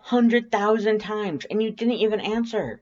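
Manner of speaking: high, loud, worked-up tough woman; a fierce friend scolding, pitched high; Undyne's voice. Acted. Worried friend scolding the narrator, high and loud.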